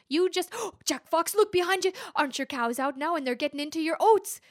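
loud, high fake alarm with gasp. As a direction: shout a sudden warning. Leprechaun feigns alarm to trick.

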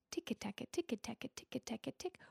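tapping sound. A narrator's reading. Tapping sound of leprechaun's hammer.

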